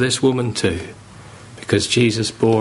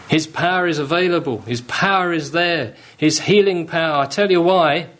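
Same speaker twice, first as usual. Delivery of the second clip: rising sermon fervour; 6 semitones higher than usual. Real, not acted. Preacher to congregation, rising fervour. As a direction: build the sermon up toward the cross. Preaching builds to full force.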